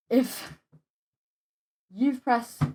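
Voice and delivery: halting, repeating words; stuttering. Real, not acted. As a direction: stumble and restart words. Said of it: "I am stuttering quite a lot".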